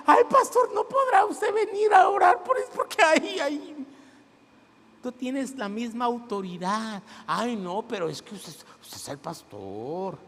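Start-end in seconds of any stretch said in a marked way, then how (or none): 0.1-3.7 high pleading whine, much higher; whiny churchgoer. Pretend. Pastor mocking a member pleading for prayer, high whine. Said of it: "no me ande usted hablando". 7.3-10.2 a hint of a low, timid excuse; lightly voiced meek member. Pastor mocking a member's excuse, lightly voiced low and timid. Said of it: "no me ande usted hablando".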